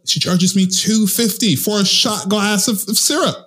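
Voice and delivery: rising high in disbelief; outraged disbelief. Retold or imitated. Telling a friend about the syrup charge, climbing outrage.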